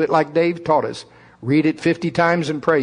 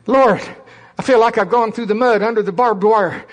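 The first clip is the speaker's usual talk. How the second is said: whiny recruit; 6 semitones higher, 4 dB louder than usual. Retold or imitated. Complaining recruit praying.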